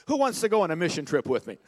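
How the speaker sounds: preacher calling for volunteers, fast loud shout; rallying shout. Real, not acted. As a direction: rouse the room to sign up. Rallying the crowd.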